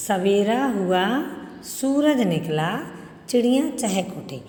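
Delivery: slow, with a pause after हुआ and after निकला; broken into phrases. Shown as a demonstration. Teacher showing where commas go, line said piece by piece with pauses. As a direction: split at each comma, हुआ and निकला held before a break. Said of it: "पूरी line को तोड़ तोड़ कर बोला".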